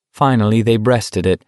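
plain reading voice

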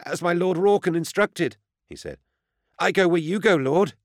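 high, lightly put-on servant voice; servile aide voice. A narrator's reading. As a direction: play the clinging, dutiful aide. Vervuk character voice.

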